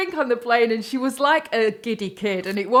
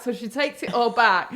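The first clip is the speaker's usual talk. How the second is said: ordinary delivery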